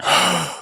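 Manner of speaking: a big audible puff of breath; exhaling. Retold or imitated. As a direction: imitate a hard breath out. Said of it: "I remember exhaling".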